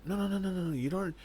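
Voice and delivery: a light mock protest; eager protest. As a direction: wave off her words in a rush. Guard protests her self-blame.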